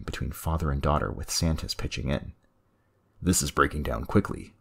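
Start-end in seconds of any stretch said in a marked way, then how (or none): none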